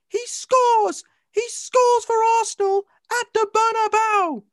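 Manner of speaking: high, loud goal commentary; Peter Drury call. Retold or imitated. Imitating Peter Drury calling Henry's goal, high and loud. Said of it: "That's Peter Drury".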